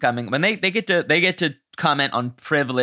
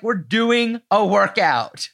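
high, drawn-out mock exasperation; imitating mad Vonda; 6 semitones higher, slower than usual. Podcaster retelling a queen's outburst over the workout, mock mad. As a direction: stretch each word in mock indignation. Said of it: "Vonda gets mad 'cause she's like".

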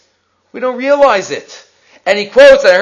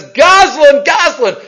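mock yell, raised and higher; retold scream; 4 semitones higher, 4 dB louder, slower than usual. Rabbi accusing a student blocking the doorway, mock yell. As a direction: call it out accusingly, short of a real scream. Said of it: "Starts screaming at him".

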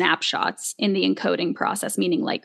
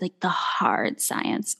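softly whispered, slowed right down; hushed unvoiced; 5 dB quieter, much slower than usual. Shown as a demonstration. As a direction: whisper it slowly and breathily.

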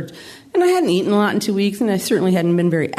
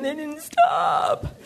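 high, drawn-out mock wail; crying wail; 10 semitones higher, much slower than usual. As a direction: imitate a sobbing sister, stretching each word into a howl. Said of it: "Now she's really crying".

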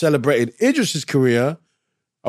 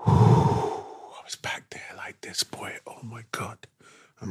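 nervous whisper; 7 dB quieter than usual. Retold or imitated. Nervous before the big take.

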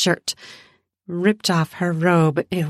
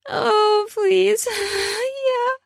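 much higher, crying out yes and please; pleading cries; slower than usual. Acted. Candy begging Kevin against the wall, high pleading cries. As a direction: beg breathlessly high, each word a cry.

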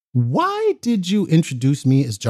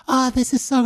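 high voice; 10 semitones higher than usual. Retold or imitated. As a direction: pitch it well up, light and breathy. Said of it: "high voice".

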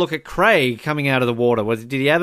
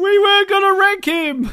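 excited exclamation; 4 dB louder than usual. Retold or imitated. Co-host recalling the ranking debate, excited exclamation. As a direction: blurt it out high and indignant.

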